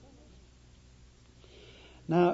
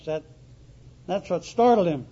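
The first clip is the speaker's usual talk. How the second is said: normal talking voice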